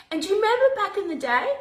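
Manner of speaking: high and quick, "do you remember"; spring the memory on the viewers eagerly; excited recall. Real, not acted. Excited nostalgic aside.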